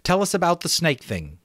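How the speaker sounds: a schoolboy showing off his island; Ralph, excited about the island; boy Ralph, eager. Acted. Ralph voiced.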